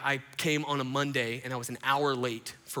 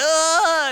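loud, high acted yell; hammed-up "ah"; joyful mock scream; 12 semitones higher, 15 dB louder than usual. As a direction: cry out as in a big emotional hug. Imitating hugging it out.

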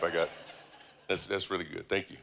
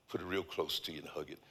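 soft and close; 5 semitones lower, 11 dB quieter, faster than usual. Real, not acted. Gentle instruction to hug Bible.

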